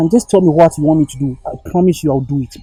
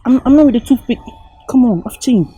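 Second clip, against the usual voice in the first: higher and louder, insisting he changed; desperate pleading shout; 7 semitones higher, 7 dB louder than usual. Acted. Boyfriend begs girlfriend to see he changed, shouting. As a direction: push hard, almost shouting, begging her to believe.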